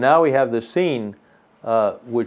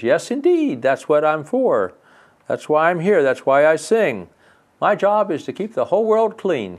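cheerful brook; 6 semitones higher, 3 dB louder than usual. Acted. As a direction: sing it out like a happy brook. Brook cheerfully answers fairy.

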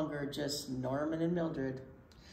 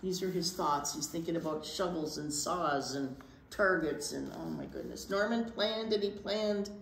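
ordinary delivery

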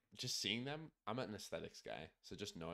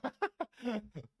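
high laugh; 6 dB louder than usual. Real, not acted. Laughs at friend's disappointment.

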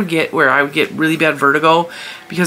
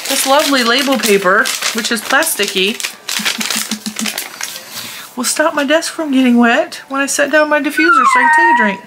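pet talk; 9 semitones higher than usual. Real, not acted. Talking to her puppy.

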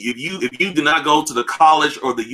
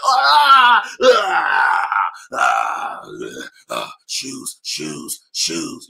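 mock anguish; 3 semitones lower, much slower than usual. Sneakerheads consumed by shoes, mock wail fading to groans. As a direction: cry out like the shoes are consuming you, then moan. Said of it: "We're like".

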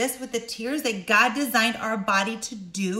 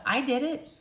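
ordinary delivery

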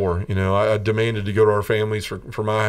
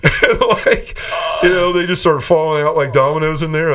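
laughing while talking; 5 semitones higher, 5 dB louder than usual. Real, not acted. Laughing while telling aunts story.

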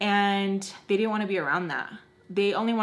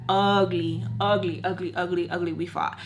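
usual speaking voice

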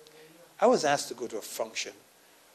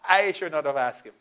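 louder prayer; 7 semitones higher, 9 dB louder than usual. Retold or imitated. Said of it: "So I said".

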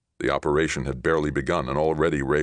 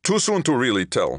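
high, louder, tense captain; tight reply; 10 semitones higher, 5 dB louder than usual. A narrator's reading. Captain reports to Johnny amid controlled chaos, tight. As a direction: answer tightly, pitched up under pressure. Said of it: "Tarvin replied tightly".

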